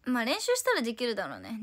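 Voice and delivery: ordinary delivery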